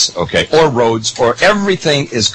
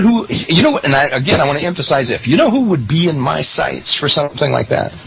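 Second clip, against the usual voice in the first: ordinary talk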